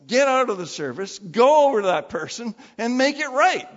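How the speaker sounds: preacher's rising, insistent command; urgent command. Acted. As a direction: drive each instruction home forcefully.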